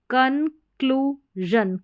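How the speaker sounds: कनक्लूजन very slowly, kun-kloo-zhun; syllable-by-syllable कनक्लूजन. Shown as a demonstration. Teacher sounding it out for learners, कनक्लूजन in slow syllables. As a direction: split कनक्लूजन into pieces with voiced zh. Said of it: "ज sound".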